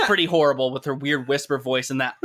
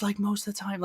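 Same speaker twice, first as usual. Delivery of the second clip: soft breathy imitation; 4 semitones higher, 8 dB quieter than usual. Shown as a demonstration. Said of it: "she's talking like this".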